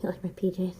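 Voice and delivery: soft teasing whisper. Real, not acted. Tarot reader teasing viewers about her pajamas, whispering. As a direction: breathe it as a playful secret.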